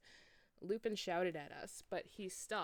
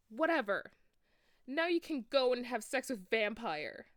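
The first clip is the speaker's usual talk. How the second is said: loud, high, dismissive yell; shout; 6 semitones higher, 9 dB louder than usual. A narrator's reading. Enoby shouting back at Draco, dismissive. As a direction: yell it back over your shoulder. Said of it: "I shouted".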